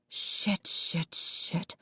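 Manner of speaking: unvoiced panicked hiss; frantic whispered shit shit shit; whispered cursing. A narrator's reading. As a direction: breathe the curses under your breath.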